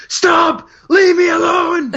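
high-pitched imitation of a wrestler's shout; Psycho Sid shouting. Hurt wrestler shooing off the referee, mock shout. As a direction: holler it high, mimicking an upset wrestler. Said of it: "He just shouts".

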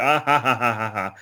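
imitated fake laugh, spelled-out ha-has; Shawn's mock laugh. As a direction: pretend to laugh, letting it fall away. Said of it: "And he's like".

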